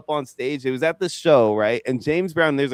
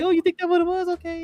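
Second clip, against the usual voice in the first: very high falsetto impression. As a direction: ask in a mimicked pop star's high, put-upon voice. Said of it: "he does his impression of [name]".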